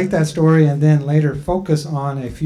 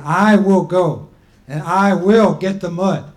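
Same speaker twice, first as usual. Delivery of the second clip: louder, slower, determined declaration; firm insistence; 3 semitones higher, 7 dB louder than usual. Acted. Grandma Turtle cutting off the people, insisting she will go. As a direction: cut them off and insist. Before they could finish.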